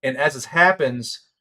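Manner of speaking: normal talking voice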